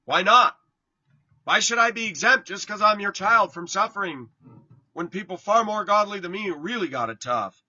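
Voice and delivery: "Why not?" shouted high and loud; loud challenge. Shown as a demonstration. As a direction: throw it up to God, full voice.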